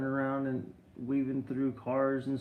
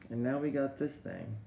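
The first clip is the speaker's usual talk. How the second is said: normal talking voice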